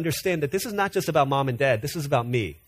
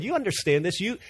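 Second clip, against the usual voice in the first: normal talking voice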